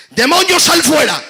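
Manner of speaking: high, loud, slow command; Jesus shouting the demon out. Acted. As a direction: order the demon out with full force.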